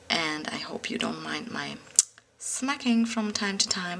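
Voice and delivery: soft unvoiced whisper, close to the mic; hushed aside to viewers about her smacking. Real, not acted. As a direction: drop to a confiding whisper.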